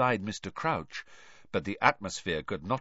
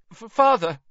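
pleading; 12 semitones higher, 8 dB louder than usual. A narrator's reading. Terrified son begging father.

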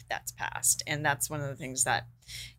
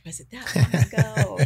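imitating a laughing guest, louder, with a laugh through it; laughing mock quote; 4 semitones higher, 8 dB louder than usual. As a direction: laugh out the disbelieving question for him. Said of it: "you're laughing like".

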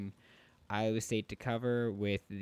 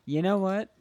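excited; 11 semitones higher, 9 dB louder than usual. Real, not acted. Sudden decision, loud high.

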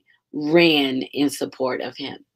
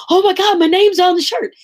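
high, loud gushing; excited runner; 13 semitones higher, 6 dB louder than usual. Retold or imitated. Runner picking up her shirt, gushing high and loud with delight. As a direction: gush like a thrilled runner spotting her name. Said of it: "they were excited about that".

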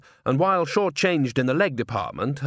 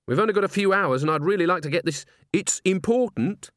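put-on higher, insistent young woman's voice; urgent young woman; 7 semitones higher than usual. Agnes pulls the organist aside, higher and urgent.

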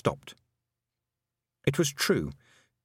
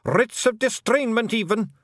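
high, slow Scottish wee man, worried; Feagle voice; 13 semitones higher, 5 dB louder than usual. A narrator's reading. A Feagle warning Tiffany about documents, high Scottish fret. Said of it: "said another".

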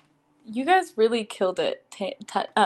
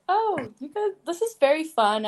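higher, pleasantly surprised retelling; pleasant surprise; 6 semitones higher than usual. Retold or imitated. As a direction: recall a happy realisation. Retelling her relieved reaction.

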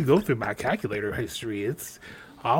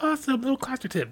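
high mock cheer; 12 semitones higher, faster than usual. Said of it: "I'm like".

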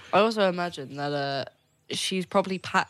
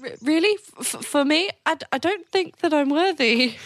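nervous stammer; 10 semitones higher, 3 dB louder than usual. Acted. Young orc overwhelmed, stammering.

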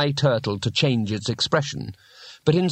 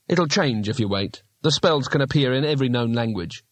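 ordinary delivery

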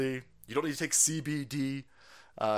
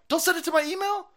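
more exasperated plea; 5 dB louder, faster than usual. Real, not acted. Repeated, more heated.

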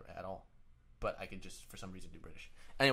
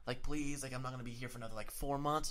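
higher-pitched pleading whine of begging friends; mock begging; 6 semitones higher than usual. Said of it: "they're begging me to go out".

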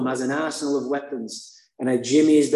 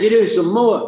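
louder, stern mock cop; mock cop order; 7 semitones higher, 8 dB louder than usual. Irritated cop ordering more tests, louder. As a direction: bark the order like a peeved cop.

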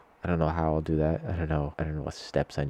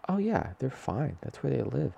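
breezy reassurance; 9 semitones higher than usual. Retold or imitated. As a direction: toss it off too casually. Mimics own earlier breezy reply.

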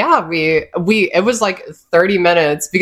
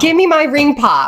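put-on high demanding kid voice; childlike whine; 9 semitones higher, 3 dB louder than usual. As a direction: whine for candy like a small child. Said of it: "you sound like my nibblings".

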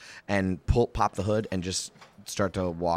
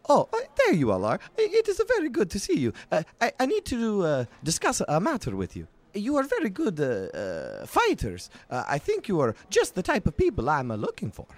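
much higher, eager stammering writer; Volo; 10 semitones higher, 4 dB louder than usual. Acted. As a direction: fuss and fluster like a pompous little chronicler. DM voices Volo.

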